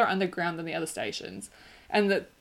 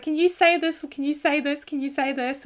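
high-pitched, repetitive imitation of pesterers; mock pestering; 8 semitones higher, 4 dB louder than usual. People pestering her to show off her accent, mock pleading. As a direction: mimic an eager nag, pitched well up.

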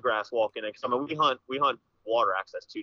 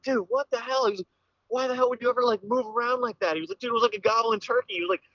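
scolding buddy; 10 semitones higher than usual. Retold or imitated.

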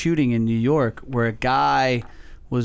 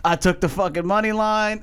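put-on New York accent; 7 semitones higher than usual. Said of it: "in this New York accent".

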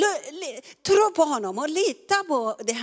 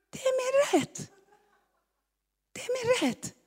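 much higher, held tone dropping at the end; high insistence; 12 semitones higher than usual. Acted. Preacher insisting on her right, high-pitched insistence.

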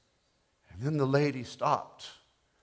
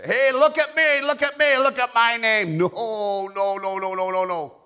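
very high, loud show-off yell; mock boastful shouting; 12 semitones higher, 11 dB louder than usual. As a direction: shout it high like a braggart, then keep crying no. Mock boast, then loud refusal.

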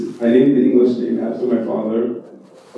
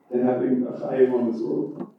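ordinary talk, nothing marked

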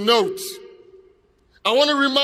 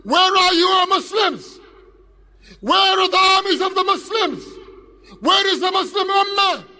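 impassioned plea; 7 semitones higher than usual. Acted. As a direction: call out desperately to a whole crowd. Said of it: "they plead with you, they beg of you".

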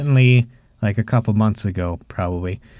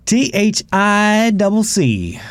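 drawn out spelling; 8 semitones higher, slower than usual. Pretend. Spelling out thicc slowly.

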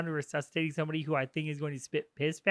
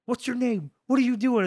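higher-pitched, pressing questions; mock interrogator; 7 semitones higher than usual. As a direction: fire questions in an acted interrogation. Retold interrogation of a captive.

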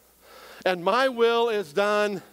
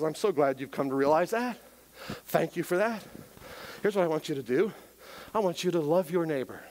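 gentle God voice; 6 semitones lower, 5 dB quieter, faster than usual. Pretend.

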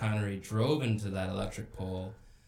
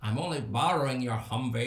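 imitated Scottish accent; amused mock Scottish accent; Sean Connery accent. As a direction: do a playful Scottish movie-star impression. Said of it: "you do an accent better".

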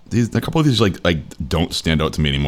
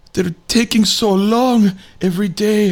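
high, slow bad Russian accent; drawn-out, high-pitched wail in mock Russian; butchered Russian accent; 13 semitones higher than usual. Host imitating a crying woman on the train, butchered Russian accent. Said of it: "I'm about to butcher a Russian, Russian accent".

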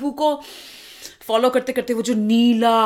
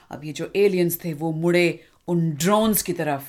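ordinary delivery

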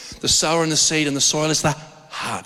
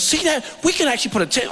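put-on higher, thrilled kid voice; excited little son; 7 semitones higher, faster than usual. Father imitating his thrilled son on the drive. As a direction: sound like a boy spotting a campsite.

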